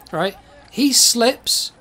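usual speaking voice

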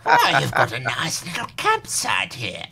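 put-on sweet little old lady greeting; a hint of a kindly grandma. Shown as a demonstration. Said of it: "you have to do the old lady voice".

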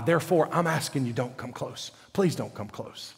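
low, hushed pleading as God; soft plea. Retold or imitated. Preacher voices God warning Israel to keep back, soft low plea. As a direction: drop low and soft, imploring them.

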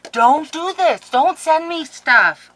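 very high, loud happy wail; shrieking, drawn out at the end; overwhelmed protest. Real, not acted. Mock protest, overcome by the gift.

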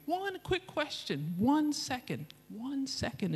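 high, slow, wheedling plea; mock pleading. Mocking his own plea.

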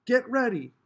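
loud, high starter's call. A narrator's reading. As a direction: call the start across the street.

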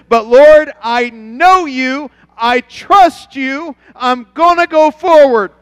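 loud, high and very slow, each word held out; climactic prayer. Acted. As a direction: proclaim it like a vow to heaven.

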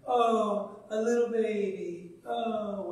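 put-on Pharaoh's daughter cooing at the baby in the basket; cooing princess. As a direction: coo like a princess who found a baby. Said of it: "and I can just imagine".

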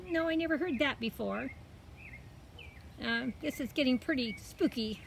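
put-on high, mildly uneasy girl's voice. Girl finds the cat spooky, mildly uneasy.

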